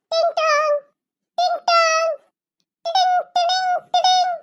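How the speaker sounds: high sung doorbell ding-dong; doorbell sound. Acted. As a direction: chime like a doorbell. Imitated doorbell.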